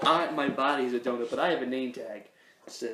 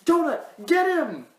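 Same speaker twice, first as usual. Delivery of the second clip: high, loud hero shout; high hammed-up superhero command; heroic call; 12 semitones higher, 4 dB louder than usual. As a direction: yell it like a cartoon crime fighter. Acted superhero scene, calling out.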